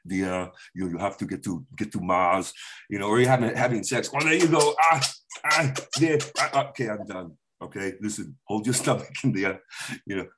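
put-on low Austrian action-star accent, lightly done; Arnold Schwarzenegger. Comic does an Arnold impression on a podcast, low Austrian accent. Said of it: "you gotta change it up and go".

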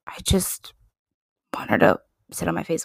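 whispered, quick confession; furtive whisper. Pretend. Fan letting slip a crush on the singer, furtive whisper. Blurts a lewd confession under breath.

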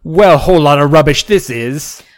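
loud hammed-up British accent; mock British accent. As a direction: grumble like a caricature Brit. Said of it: "stopped doing that accent".

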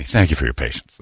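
mock pleasant hotline voice; soothing phone rep. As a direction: imitate a rep soothing an angry caller. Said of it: "That's the one that gets me".

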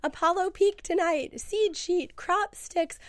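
ordinary talk

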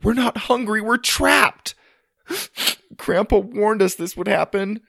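high, loud, distraught cry; emotional outburst. Acted. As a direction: wail it, gasping between sentences. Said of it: "sudden display of emotion".

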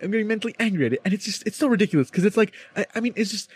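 high, stammering, whiny mock student; flustered student impression. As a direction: play the rattled classmate groping for words, pitched high. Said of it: "he's like".

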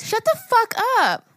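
high-pitched shocked outburst; shocked disbelief. Real, not acted. Friend hearing it was used, high shocked outburst. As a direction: exclaim it in total disbelief.

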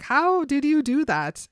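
mock amazement. Edward to Bella after the race, mock amazed. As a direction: marvel loudly, acting out his surprise.

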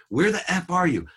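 high, loud accusing demand; blaming. Retold or imitated. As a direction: imitate an angry father yelling down the phone. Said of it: "Blame, right".

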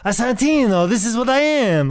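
high, loud mock-Italian voice; high-pitched fake Italian exclaiming; fake Italian accent. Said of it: "talk like a fake Italian".

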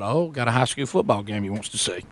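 low, soft sardonic aside; private thought. Retold or imitated. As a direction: drop voice as if thinking to yourself. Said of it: "And I thought".

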